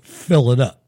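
a short, bossy demand, falling at the end; curt customer order. Retold or imitated. Rich customer ordering the gas attendant, a curt demand. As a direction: order it like you own the place. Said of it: "and they were like".

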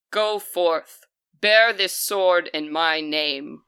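slow, stately charge to a champion; commanding water spirit. Acted. Water spirit hands over the sword, solemn and commanding. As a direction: send the fighter off with ceremony. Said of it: "And she says".

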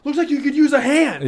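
imitated braggart rubbing it in; Gaston. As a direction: goad like a cocky showman. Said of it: "now he's gotta be the braggart Gaston".

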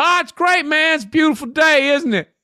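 high, put-on Southern cabbie voice; mock Southern small talk, high-pitched; hardcore Southern accent. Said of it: "I'm hearing the accent".

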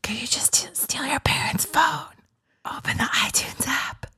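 hushed conspiratorial whisper; soft stage whisper. Pretend. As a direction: whisper instructions to a baby. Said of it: "really hard to talk like that".

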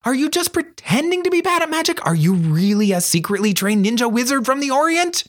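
very high-pitched, eager string of questions; excited. A narrator's reading. Girl imagining her friend as a secret ninja wizard, excited squeal. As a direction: squeal the questions with rising wonder. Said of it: "she demanded excitedly".

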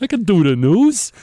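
loud, high, hammed-up Brooklyn accent; playing up a Brooklyn accent. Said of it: "very pronounced Brooklyn accent".

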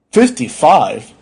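slow, loud, disbelieving repeat; stunned doctor, stretching the words; appalled echo. Acted. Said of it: "The doctor stared in horror".

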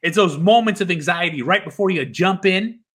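usual speaking voice

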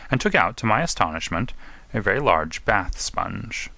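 plain reading voice

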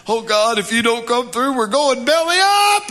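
high, loud, desperate cry to God; panicked prayer. Acted. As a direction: plead like everything's about to collapse.

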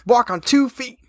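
high, put-on know-it-all voice; mock objector. Imitating somebody who objects about bears, mock high voice. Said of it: "somebody was like".

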